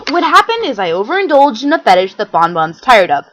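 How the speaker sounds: ordinary delivery, nothing marked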